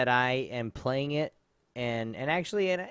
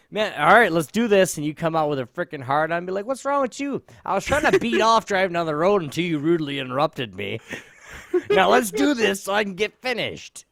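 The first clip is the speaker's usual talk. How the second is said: mock tough guy; 4 semitones higher, 6 dB louder than usual. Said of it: "you could say".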